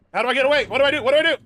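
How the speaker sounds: high, loud panicked repeat; frantic, pleading for help. Real, not acted. Panicking in horror game.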